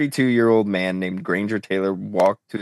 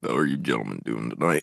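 deep, soft late-night radio host; sexy radio voice; 10 semitones lower, 5 dB quieter, faster than usual. Acted. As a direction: purr it like a smooth DJ. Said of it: "put on a sexy radio voice".

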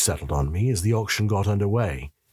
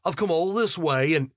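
higher-pitched, indignant demand; 8 semitones higher than usual. A narrator's reading. Big Yorkshire dealer complaining to Lovejoy, demanding. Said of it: "Tanker demanded".

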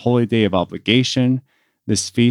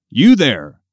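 loud, commanding call; sudden loud summons; priest calling out; 3 semitones higher, 7 dB louder than usual. A narrator's reading. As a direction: call him out from the crowd. Priest calls man out of crowd.

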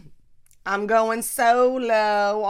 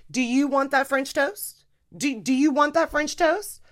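pointed challenge; 6 semitones higher, 4 dB louder than usual. Retold or imitated. Daughter challenging her pouty mom.

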